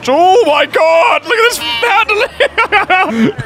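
high-pitched, drawn-out wail breaking into laughter; real cracking-up disbelief, slow and high; laughing outcry. Real, not acted. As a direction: cry it out while laughing. Car handles terribly, laughing outburst.

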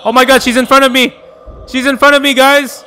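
high, loud, fast alarm; scared rushed yelling. Real, not acted. Streamer telling chat the ghost is in front of him, scared yelling. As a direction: blurt it out in a panic.

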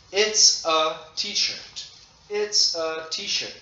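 slow, every word spelled out; slow model sentence. Shown as a demonstration. Teacher modelling a sentence slowly for the class. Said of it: "let's make a sentence".

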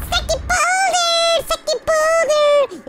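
very high-pitched taunting cry; mock taunt. Player to the boulder that tried squeezing them, high mock taunt. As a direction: crow it way up high, drawing out the end.

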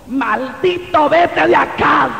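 acted angry banishment, slow and rising; padre airado imitado. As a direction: imitate a father throwing his son out, stretching each word. Acts out father throwing him out. (imitando a un padre que echa al hijo, lento y subiendo la voz)

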